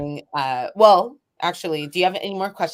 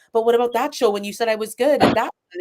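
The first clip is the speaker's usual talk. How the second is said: higher, quick insecure protest; doubtful protest; 7 semitones higher, 3 dB louder, faster than usual. Retold or imitated. Poet questioning her supporter's praise, insecure protest. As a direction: blurt out a hurt question, quickly.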